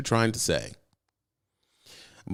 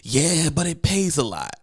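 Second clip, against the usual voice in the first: higher, slow, coaxing sales pitch; pushy dispatcher; 5 semitones higher than usual. Shown as a demonstration. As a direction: coax the driver slowly. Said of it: "this is how it sounds".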